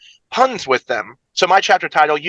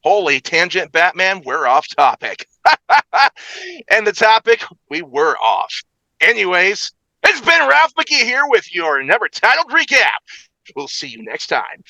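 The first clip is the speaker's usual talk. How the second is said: game-show style host with high, punched countdown calls; big showy countdown host voice; hyped recap announcer; 6 semitones higher than usual. Acted. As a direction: belt out each number, then ease into the comments. Hosts recap as Ralph McGee.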